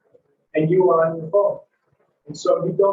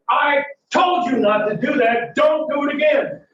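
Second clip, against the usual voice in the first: raised, higher-pitched angry scolding; angry warning; 8 semitones higher than usual. Acted. Boyfriend squeezing his partner's arm as he scolds, angry warning. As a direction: come down hard with a sharp, acted reprimand. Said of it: "raising his voice and getting angry".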